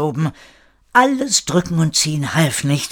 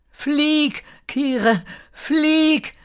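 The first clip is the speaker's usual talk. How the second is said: high, thin sister's cry; gull-like call; 13 semitones higher, much slower than usual. A narrator's reading. Sister calls Kire to let go and fly, high gull-like cry. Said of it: "seine Schwester rufen, als sei sie die Möwe".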